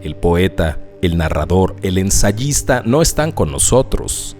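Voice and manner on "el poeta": ordinary talk, nothing marked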